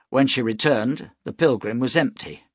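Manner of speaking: usual speaking voice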